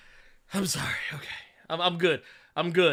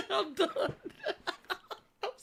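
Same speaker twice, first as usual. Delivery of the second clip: high, slow, teary laugh-squeak; crying with laughter; 12 semitones higher, 8 dB quieter, slower than usual. Real, not acted. Host apologizing to the chat, helpless with laughter. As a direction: apologize while weeping with laughter.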